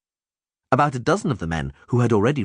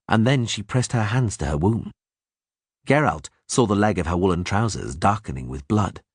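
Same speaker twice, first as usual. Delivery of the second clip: ordinary delivery, nothing marked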